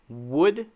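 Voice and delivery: "Would" with lips rounding and releasing the W; correct W. Shown as a demonstration. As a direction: start "Would" with a clear lip W, rising. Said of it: "the first one is right".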